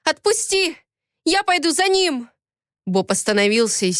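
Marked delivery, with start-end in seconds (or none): 0.0-2.3 high, frantic demand; urgent high-pitched insistence; desperate protest. A narrator's reading. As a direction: cry out to be released. Heroine struggling, still crying out.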